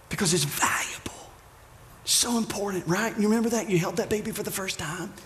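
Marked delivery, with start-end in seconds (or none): 0.1-1.3 whisper. Shown as a demonstration. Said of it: "we talk lower".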